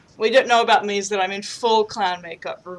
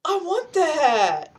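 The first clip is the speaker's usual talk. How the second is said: put-on childlike excitement; 8 semitones higher, much slower than usual. Childish delight at clown idea.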